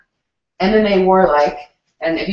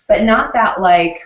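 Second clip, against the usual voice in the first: ordinary delivery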